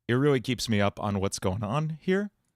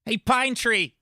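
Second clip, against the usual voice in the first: much higher, louder demonic cartoon voice; Bill Cipher; 11 semitones higher, 3 dB louder, slower than usual. Taunting the boy he nicknamed, high gleeful demon voice. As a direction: greet your victim with glee. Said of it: "Oh, Bill Cipher".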